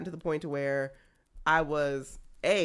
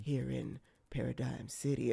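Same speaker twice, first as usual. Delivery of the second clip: noir narrator; 4 semitones lower, 7 dB quieter than usual. Retold or imitated. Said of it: "when he's like".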